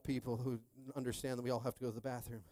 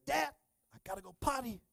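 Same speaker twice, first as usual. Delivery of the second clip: put-on two-year-old; 9 semitones higher than usual. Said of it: "we have a two-year-old".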